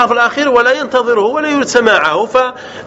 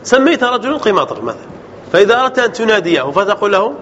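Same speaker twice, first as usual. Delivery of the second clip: normal talking voice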